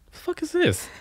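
high mock-baffled question; baffled outrage. Handed Swedish fish, high confused squeak. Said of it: "I'm like".

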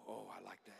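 a private delighted murmur; quiet aside to himself; muttered aside. Real, not acted. Preacher's aside, barely audible.

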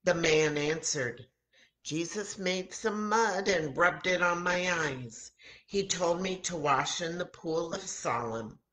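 ordinary delivery, nothing marked

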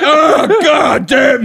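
high, loud, drawn-out curse; hammed-up angry bellow; mock exasperated shout. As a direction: imitate a fed-up dad yelling. Dad furious on waking.

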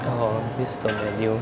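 usual speaking voice

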